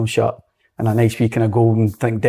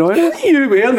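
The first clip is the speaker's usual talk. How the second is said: much higher mock disbelief; teasing jibe; 13 semitones higher than usual. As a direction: poke fun at the new boy's clothes. Teammate mocks new signing's outfit.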